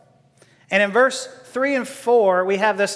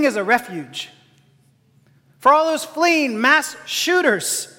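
preaching refrain; 3 semitones higher than usual. Acted. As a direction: build the refrain like a rising sermon.

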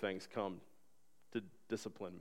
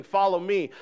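higher, louder "Follow me."; proclaimed command; 6 semitones higher, 8 dB louder than usual. Acted. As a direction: ring out "Follow me." to the room. God's command proclaimed aloud.

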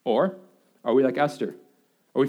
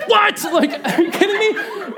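high, incredulous outburst; hammed-up astonishment; 14 semitones higher, 4 dB louder than usual. Dad told his baby rolled over, astonished. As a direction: blurt it out in mock disbelief. Acts out amazed reaction to baby news.

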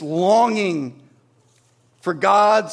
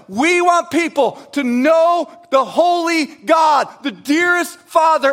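preaching shout; 8 semitones higher, 5 dB louder than usual. Real, not acted. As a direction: bring it up to a full preaching cry.

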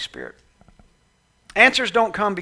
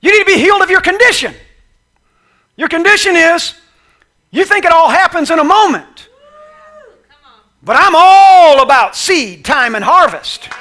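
loud, high preacher's build; preacher proclaiming God's ways; preaching at full force; 12 semitones higher, 13 dB louder than usual. Acted. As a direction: drive it home with fire.

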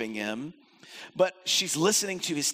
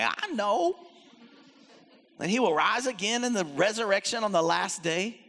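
a bit of sass; 4 semitones higher than usual. Retold or imitated. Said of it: "I think there's a little bit of sass".

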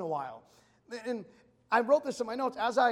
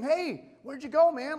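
crowd calling out; 6 semitones higher, 7 dB louder, slower than usual. Retold or imitated. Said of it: "These people came".

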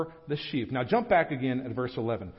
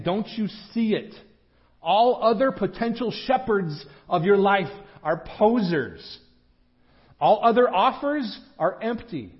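raised, louder, urgent appeal; pleading; 5 semitones higher, 5 dB louder than usual. Retold or imitated. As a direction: plead with them insistently, higher and forceful. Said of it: "Jesus is pleading with the disciples".

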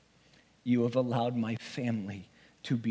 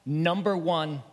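ordinary delivery

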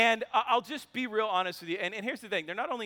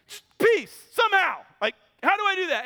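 high, loud, fast near-shout; frantic raised cry, racing at the end; exasperated outburst; 9 semitones higher, 6 dB louder than usual. Retold or imitated. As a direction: throw up your hands and shout it. Exasperated plea to counselor.

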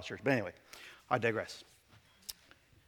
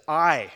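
"I" hit loud on its own; emphatic I; 6 dB louder than usual. Shown as a demonstration. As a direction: punch the word "I" hard. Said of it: "It's very emphatic".

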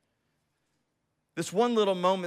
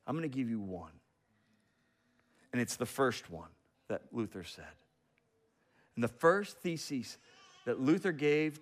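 much softer, low hushed telling; hushed reveal; 6 semitones lower, 7 dB quieter than usual. Retold or imitated. Professor leaning in to reveal the thesis, hushed. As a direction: lean in and share a secret.

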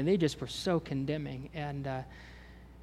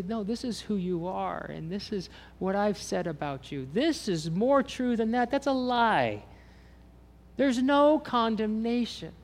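voicing Christ; 6 semitones higher than usual. Preacher voicing Christ declaring no condemnation, high and firm. Christ's words quoted in sermon.

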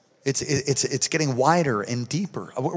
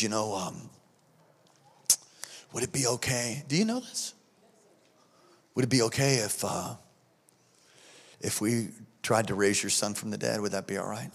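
hushed hesitant ask; 6 semitones lower, 10 dB quieter than usual. Retold or imitated. Friend asks to raise son.

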